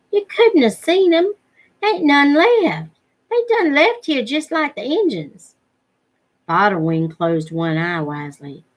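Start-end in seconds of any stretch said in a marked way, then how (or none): 0.1-5.5 put-on boy voice. Jody voiced, disbelieving the tale.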